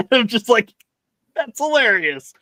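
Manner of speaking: laughing through the words; giggling reaction, voice up high. Real, not acted. Podcaster recalling a funny line, laughing.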